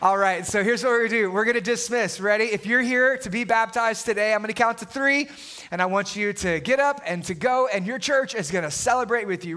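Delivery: usual speaking voice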